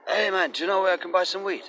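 high voiced stranger wanting weed; guy asking for weed. Stranger begging the narrator for weed, high mock voice. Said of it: "some guys come up to me".

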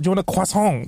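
put-on CROISSANT suddenly in a French accent; everyday question, CROISSANT overdone in French; French accent on one word. Offering a friend something from the shop, French-accented CROISSANT. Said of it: "going into another accent for one word".

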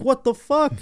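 high, incredulous what-the-hell; stunned husband. Retold or imitated. Husband hearing his wife's podcast confession, shocked and high. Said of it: "Matt Stafford's just like".

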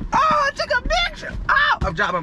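sudden high fast squeal; high panicked blurt; startled. Real, not acted. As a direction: yelp as the phone slips. Fumbling his phone.

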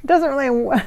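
ordinary delivery

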